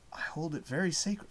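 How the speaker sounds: soft, held-back quote voice; hushed movie line. Retold or imitated. Trying to recall a film line, hushed. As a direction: recite it very quietly. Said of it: "saying the line like that".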